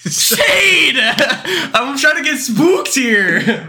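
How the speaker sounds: high, drawn-out protest through laughter; loud, laughing mock outrage; mock scolding. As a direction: whine a complaint while cracking up.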